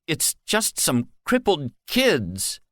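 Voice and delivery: trembling disbelief; guard stunned by what he did; shaking voice. Acted. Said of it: "His voice shook".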